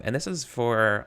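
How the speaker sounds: ordinary talk, nothing marked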